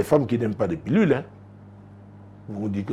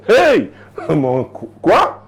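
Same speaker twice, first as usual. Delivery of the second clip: higher, louder startled outcry; mock shock; 7 semitones higher, 5 dB louder, much slower than usual. Real, not acted. Preacher to congregation, playful shocked outburst.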